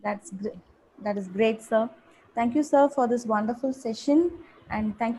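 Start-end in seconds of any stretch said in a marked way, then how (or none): none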